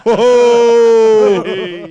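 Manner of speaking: very loud, high, long howl of laughter; howling at the jibe; big laugh. Real, not acted. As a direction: burst into a real roaring laugh. Joke lands on the host.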